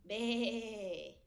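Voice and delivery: loud bleating baa; playful teacher baa; sheep bleat. Shown as a demonstration.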